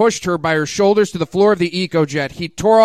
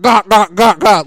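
mock choking noises; 6 dB louder than usual. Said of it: "Linka retorted".

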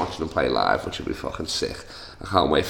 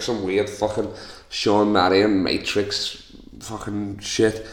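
ordinary talk

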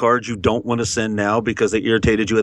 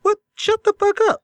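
exasperated squeal, pitched way up; incredulous outburst. Retold or imitated. Griping to his co-host about a clerk, incredulous squeal. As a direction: blurt it out in mock disbelief. Disbelief at holiday greeting.

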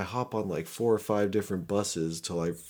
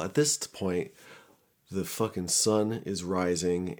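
ordinary talk

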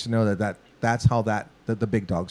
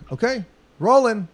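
higher, announcing the take over the booth speaker; producer on talkback; 11 semitones higher, 4 dB louder than usual. Retold or imitated. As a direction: call "rolling" like the man behind the glass.

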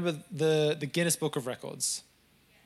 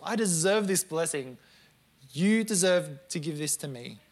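mock entitled prayer; 3 semitones higher, 4 dB louder than usual. Believer demanding a miracle from God, in a mock whiny loud plea. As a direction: demand blessings from God like a spoiled child.

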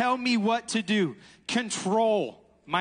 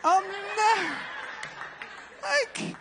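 laughing; 10 semitones higher, slower than usual. Real, not acted. Laughing at own never list.

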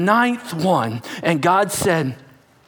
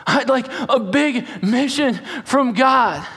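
higher, slow whiny mock plea; drawn-out mock plea; 8 semitones higher, slower than usual. Congregant mockingly begging God for a mission, drawn out. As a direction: stretch it out like a whiny kid.

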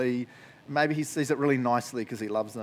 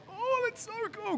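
much higher, thrilled squeal; delighted gasp; 5 dB quieter than usual. Retold or imitated. Pastor recalls reading the line this week, squealing with delight. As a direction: gush with sudden delight. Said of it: "I was like".

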